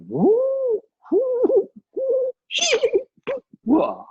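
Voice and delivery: high whooping "wuh" cries, ending in a "wah"; imitated ride screams. Preacher mimicking a roller coaster ride for the congregation, high whoops. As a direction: whoop like riders on a plunging coaster.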